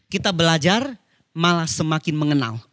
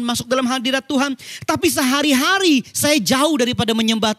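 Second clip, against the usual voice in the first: raised, driving sermon delivery; impassioned preaching; 7 semitones higher than usual. Real, not acted. Preacher urging fellow worship servants to live as worshippers, impassioned.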